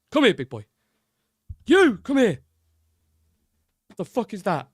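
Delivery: hammed-up Ramsay rant; Gordon Ramsay impression. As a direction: bark it like an angry TV chef. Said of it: "my, uh, Gordon Ramsay impression".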